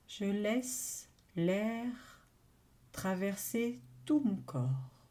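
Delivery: ordinary delivery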